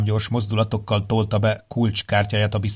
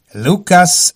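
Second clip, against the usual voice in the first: calling out; 8 semitones higher, 7 dB louder than usual. Acted. Caller calls the name.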